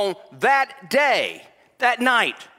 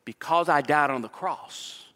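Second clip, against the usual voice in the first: tender hushed preaching; 7 semitones lower, 5 dB quieter than usual. Acted. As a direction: draw the congregation in close and gently. Tender preaching on Jesus' peace.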